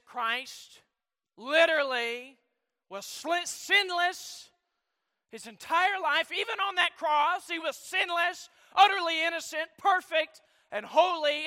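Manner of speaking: loud, high preaching climax; preaching at full force. Acted. Preacher proclaiming Christ's innocence to the congregation, loud and high.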